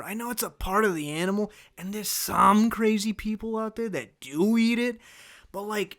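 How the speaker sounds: worked-up, emphatic disbelief; exasperated rant. Real, not acted. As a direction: rant at the audience in comic outrage. Mock-outraged rant about liver.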